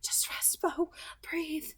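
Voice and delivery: soft whisper close to his ear; whispered comfort. Acted. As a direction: hush him gently right by his head. Corinne's voice right next to head.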